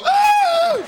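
sudden very high, loud yelp of alarm; doctor's shocked cry. Retold or imitated. Doctor seeing the burned patient, high shocked yelp. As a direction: shriek like the doctor saw the burns. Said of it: "when the doctor go".

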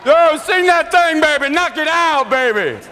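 high, loud hyped fan, stretching the words; loud opera fan. Retold or imitated. Opera-goer cheers [name] from the seats, in a loud, slow, high holler. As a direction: holler up at the stage like a superfan. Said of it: "This one man who comes to the opera going".

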